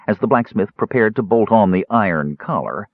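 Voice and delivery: usual speaking voice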